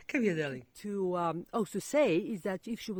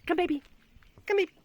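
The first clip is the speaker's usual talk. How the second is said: pet talk; 12 semitones higher, 7 dB louder, much faster than usual. Real, not acted. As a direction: coax the dog with a high sing-song. Calling the dog sweetly.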